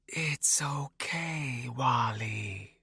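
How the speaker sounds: slow, ghostly wraith voice, drawn out; haunting ethereal voice. A narrator's reading. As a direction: soothe him like a ghost from far away. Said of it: "she said in a haunting, ethereal voice".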